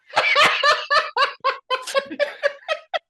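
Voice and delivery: high, loud burst of laughter; big laugh. Real, not acted. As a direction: crack up laughing. Laughing at Boston accent joke.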